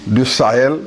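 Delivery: ordinary delivery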